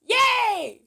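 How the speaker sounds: high, loud little yay; excited cheer. Real, not acted. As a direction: cheer like you just won. Cheering her own joke.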